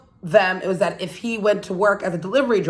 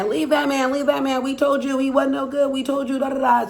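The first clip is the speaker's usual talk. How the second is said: lightly voiced, higher nagging sisters; a hint of sisters pestering her; 5 semitones higher than usual. Sisters urging her to leave her man, higher nagging tone. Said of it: "our sisters are in her ear".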